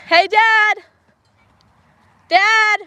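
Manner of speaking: calling out loud to someone distant; calling from far away. Real, not acted. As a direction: call out across the yard. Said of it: "Trying to yell over to him".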